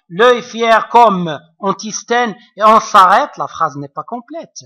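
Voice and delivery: normal talking voice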